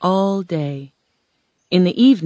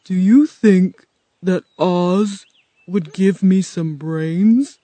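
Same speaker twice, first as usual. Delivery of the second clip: slow, louder scarecrow voice; hopeful scarecrow; 4 semitones higher, 6 dB louder, slower than usual. Acted. Scarecrow wondering about getting brains, slow and hopeful. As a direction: ask it slowly, with naive hope.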